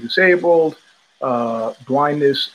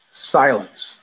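ordinary talk, nothing marked

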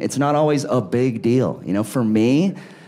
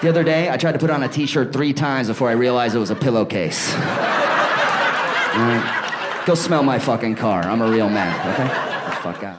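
mock stern uncle; 4 semitones lower than usual. Uncle confronts his niece about weed, low and mock stern. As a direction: pull the kid close and lay into her. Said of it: "So I was like".

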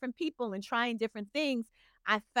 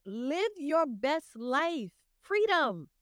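slow encouragement; 4 semitones higher, much slower than usual. Acted. Host encouraging guest warmly.

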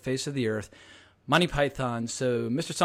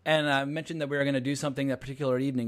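usual speaking voice

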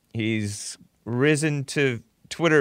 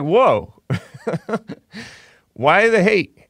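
loud, higher, laughing disbelief; mock astonishment; 7 semitones higher, 4 dB louder, slower than usual. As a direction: act out being shocked, with a laugh. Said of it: "I'm like".

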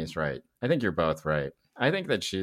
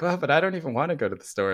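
higher voice; 8 semitones higher, faster than usual. Retold or imitated. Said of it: "sounding like".

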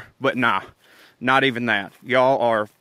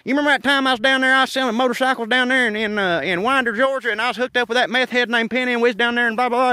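[name] imitating me; 9 semitones higher, faster than usual. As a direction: rattle it off like a hyper storyteller. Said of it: "that's his impression of me".

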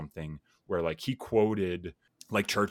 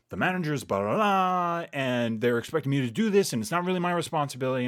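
higher, louder, mock-exasperated rant; frustrated venting; 6 semitones higher, 4 dB louder than usual. Coworker venting to her colleague, imitated frustrated rant. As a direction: rattle off the coworker's complaints, getting faster at the end. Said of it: "she got frustrated and she was like".

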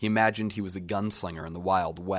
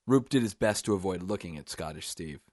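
ordinary delivery, nothing marked

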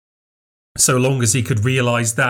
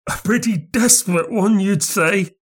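much higher, strange thin voice; odd little man; 10 semitones higher than usual. A narrator's reading. Bearded man answering Craven ominously, thin and strange. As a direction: let the voice nearly die away.

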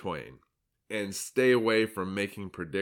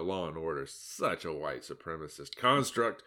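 ordinary delivery

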